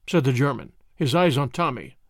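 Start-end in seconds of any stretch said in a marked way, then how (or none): none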